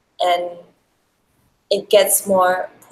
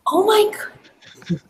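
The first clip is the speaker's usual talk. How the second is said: high, slow drawn-out groan of disbelief; exasperated; 13 semitones higher, much slower than usual. Real, not acted. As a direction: stretch it out, mock-horrified. Reacting to the fart joke.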